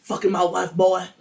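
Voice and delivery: loud mock-angry accusation; mock screamed accusation. Husband confronting the younger assistant, loud mock accusation. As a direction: hit it hard like a jealous husband. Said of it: "James is screaming at them".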